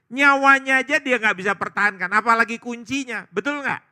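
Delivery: high, emphatic pulpit exclamation; full-force preaching. Real, not acted. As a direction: drive the point home forcefully. Preacher driving the point home.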